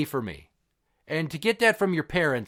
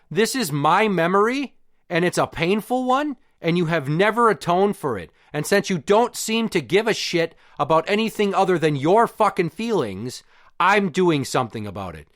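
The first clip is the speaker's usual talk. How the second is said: emphatic, higher-pitched retold comeback; angry retort; 3 semitones higher, 3 dB louder than usual. Son answering mom over the photo, heated and insistent. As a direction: fire back indignantly, punching the key words.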